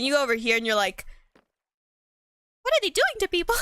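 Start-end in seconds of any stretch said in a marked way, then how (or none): 2.6-3.6 high-pitched aghast question; playacted high-voiced dismay; mock horror. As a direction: react appalled, hamming it up. Character reacts aghast to room.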